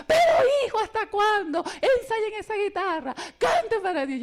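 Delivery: high, loud, laughing scold; scolded by the priest, fast, high and loud; priest's scolding. Retold or imitated. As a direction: lay into them like an exasperated priest, half laughing. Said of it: "nos echaba ese regaño".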